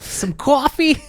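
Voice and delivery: high echoed Boston voice; thick Boston accent. Acted. Co-host echoing grandmother, thick Boston accent. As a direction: parrot the broad Boston vowel. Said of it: "super thick accent".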